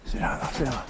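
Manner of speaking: softly urgent command; hushed order. Real, not acted. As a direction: keep it down, tell them to sit. Hushed order under fire.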